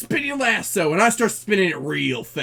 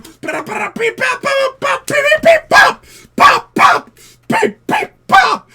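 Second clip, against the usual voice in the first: pops climbing much higher and louder; escalating pops; 11 dB louder, slower than usual. Acted. Cowboy firing wildly at the clones, escalating shouted pops. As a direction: squeal the shots up into a shriek.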